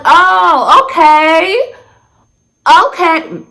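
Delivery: high, drawn-out acted "oh, okay"; feigned non-judgment. Storyteller reacting to a friend's admission, trying not to judge. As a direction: lift it high, politely taken aback. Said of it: "non-judgmental ass".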